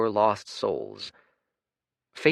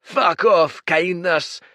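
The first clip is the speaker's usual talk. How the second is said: much higher, louder, slow furious roar; booming creature; 11 semitones higher, 10 dB louder, slower than usual. Acted. Red giant bellowing at the rowing stranger, furious roar. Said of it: "snapped the big red one".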